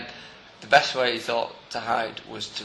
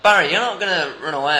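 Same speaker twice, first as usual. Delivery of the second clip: higher, louder questioning; policeman role-play; 4 semitones higher, 7 dB louder than usual. Acted. Police officer to getaway driver, mock questioning pitched up. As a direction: call over to the driver, play-acting police.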